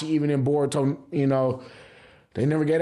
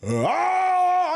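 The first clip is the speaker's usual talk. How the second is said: excited yell; 12 semitones higher than usual. Retold or imitated. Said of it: "I'm sitting there on the couch like".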